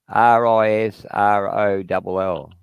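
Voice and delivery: slow letter by letter spelling; spelled out slowly. Shown as a demonstration. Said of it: "Spell that for us".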